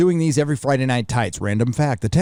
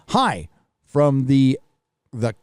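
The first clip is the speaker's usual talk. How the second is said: ordinary talk, nothing marked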